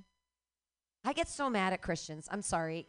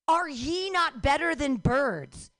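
loud, slow mock-pompous reading; mocking scripture; 5 semitones higher, 7 dB louder, slower than usual. Comic mocking the Bible verse, slow and loud. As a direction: read it with sneering grandeur.

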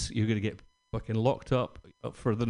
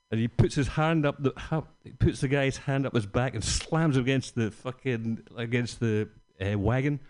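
usual speaking voice

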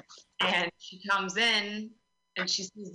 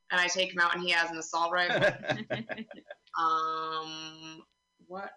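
ordinary talk

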